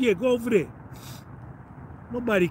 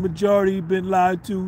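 usual speaking voice

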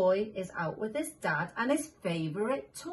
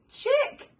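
squeaky chick; 5 dB louder than usual. Acted. Reading animal book to child.

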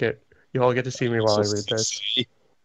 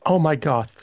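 mock gasp; 5 semitones higher, 6 dB louder than usual. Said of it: "Slugborn gasped".

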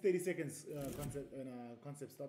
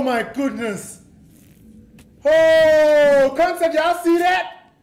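very high, full-volume victory screams; ecstatic shouting; 12 semitones higher, 20 dB louder, much slower than usual. Real, not acted. Gamer shouting to viewers after killing Banana Man, ecstatic. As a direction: yell in triumph, stretching out the cheers.

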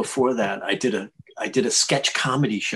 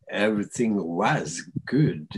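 put-on a light Czechoslovakian accent, slowed. Actor recalls his Czechoslovakian accent as Death. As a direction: slip briefly into a Czechoslovakian accent. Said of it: "I start doing the Czechoslovakian accent".

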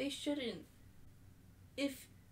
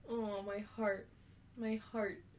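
ordinary talk, nothing marked

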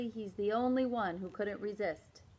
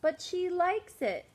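put-on high, louder kid voice; protesting kid; 8 semitones higher, 4 dB louder than usual. David defending himself, high kid voice. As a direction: argue your case.

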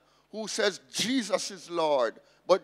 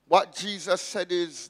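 usual speaking voice

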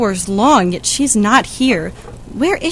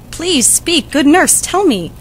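pleading; 6 semitones higher than usual. Acted. Juliet begging for the news.

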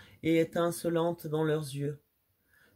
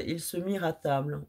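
plain reading voice

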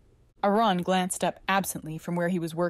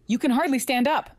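snapping; 7 semitones higher, 4 dB louder than usual. A narrator's reading. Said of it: "he snapped".

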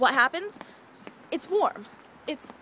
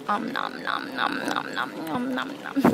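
mock munching noises; slower than usual. Pretend eating noises.

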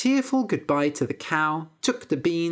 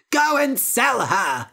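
slow, loud, high command; mother crying out; 7 semitones higher, 5 dB louder than usual. Acted. Mother ordering Jack to sell the cow, loud and slow. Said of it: "cried his mother".

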